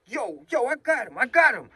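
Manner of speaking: high, urgent mock call; pretend pursuer. Thief pretending to chase his partner, high urgent call-out. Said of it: "I'm pretending like I'm chasing Ilya".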